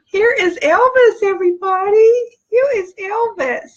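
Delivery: excited high voice introducing Elvis; proud puppy reveal. Real, not acted. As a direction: show off the new puppy with delight. Proudly presenting the new puppy.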